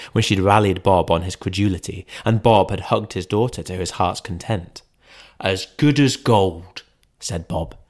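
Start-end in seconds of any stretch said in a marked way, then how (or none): 5.4-6.8 slow, mildly trembling father; a father moved by his son; tremulous. Acted. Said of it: "Bob's voice was tremulous".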